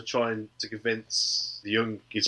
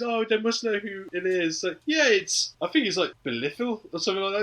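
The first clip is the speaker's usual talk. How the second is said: lightly voiced chatter, much higher; high mock voices; 8 semitones higher than usual. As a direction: play it up high and breezy. Auntie and Sophie voiced.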